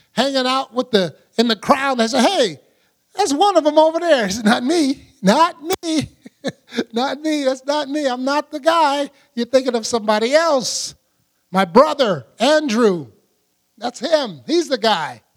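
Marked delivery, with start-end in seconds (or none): 2.2-4.3 high, quick pointing-out call; accusing bystander. Acted. 4.4-15.2 high, hurried brushing-off denial; nervous denial. Acted. Peter denies, acted quote.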